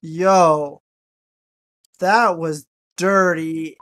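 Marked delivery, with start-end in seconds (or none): none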